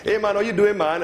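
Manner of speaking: bright bouncing Trinidadian hello; happy funny Trinidadian. Retold or imitated. Imitating how Trinidadians greet people, happy Trinidadian sing-song. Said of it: "they talk like this".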